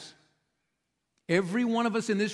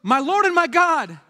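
much higher, louder, slow, overwhelmed cry; awed exclamation; 9 semitones higher, 6 dB louder than usual. Retold or imitated. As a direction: cry it out as if seeing the risen Lord. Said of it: "Thomas goes".

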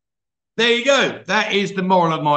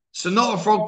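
usual speaking voice

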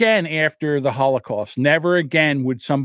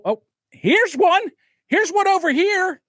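calling out; 10 semitones higher than usual. Retold or imitated. Civilians imagined pointing out fighters.

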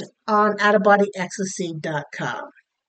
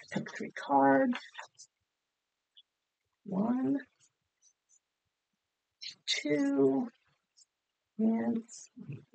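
much softer, absent-minded counting; murmuring to self; 10 dB quieter, slower than usual. Real, not acted. Host laying out oracle cards, murmuring to herself. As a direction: count softly to yourself, with long pauses between.

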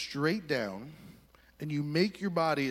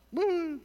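gleeful high woo; 13 semitones higher than usual. Retold or imitated. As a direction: whoop it as you ski away. Buddy skis off happily.

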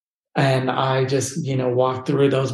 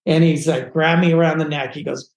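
ordinary talk

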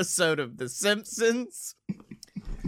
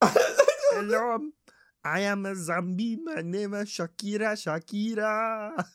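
[name] as a Simpsons voice. Acted. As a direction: play [name] like a Simpsons character. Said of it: "you sound like a fucking Simpsons character".

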